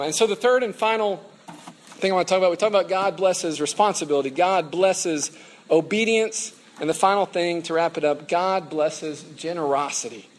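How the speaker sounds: loud, deliberate sermon delivery; preaching. Real, not acted. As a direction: proclaim the main point to the whole room, loudly. Preaches the closing main point.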